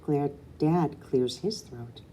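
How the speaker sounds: ordinary delivery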